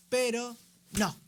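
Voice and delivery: emphatic, drawn-out refusal; firm no. Acted. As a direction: shut the temptation down hard.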